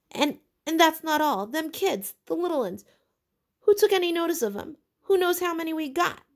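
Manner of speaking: rising into a high, loud, breathless rant; Piggy ranting at the boys who ignore him; furious tirade. A narrator's reading. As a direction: scold until out of breath. Tirade continues, higher.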